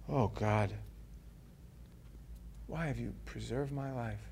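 low and soft; wondering prayer. Acted. David asking God quietly why he was spared. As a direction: let it fall quiet in awe.

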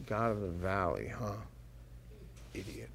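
low, soft sneering mutter; scornful king. Acted. Ben-Hadad sneers at Ahab to his servant, low scornful mutter. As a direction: sneer at the fool. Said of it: "Ben-Hadad looks at him and goes".

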